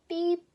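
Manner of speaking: very high, loud piping beep; squeaky beep. Acted. Baby crow trying to sing, high beep. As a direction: pipe a tiny beep.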